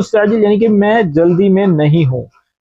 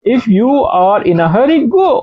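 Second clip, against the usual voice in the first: slow and clear; 3 semitones higher, much slower than usual. Shown as a demonstration. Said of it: "slow English gloss".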